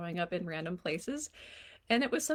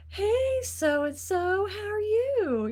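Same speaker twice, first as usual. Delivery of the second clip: much higher and louder, bouncy and drawn out; silly sing-song greeting; 12 semitones higher, 7 dB louder, much slower than usual. Shown as a demonstration. Mom greeting her teen at the door, silly sing-song. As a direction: greet them like a cartoon cheerleader. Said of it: "add a little bit of a silliness to it".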